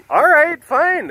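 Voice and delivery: high, loud giving-in; hammed-up exasperated agreement; mock exasperation. Acting out exasperated man and boy.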